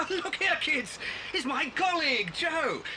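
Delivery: high, delighted exclamations; joyful surprise. Acted. Bill telling the kids Joe has come, joyful surprise. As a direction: burst out in glad recognition.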